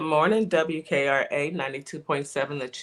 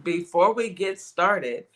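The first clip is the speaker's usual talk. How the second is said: usual speaking voice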